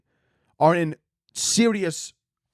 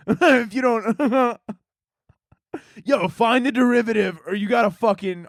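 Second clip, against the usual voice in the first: laughing through it; 5 semitones higher than usual. Retold or imitated. Laughing while quoting frat scientist.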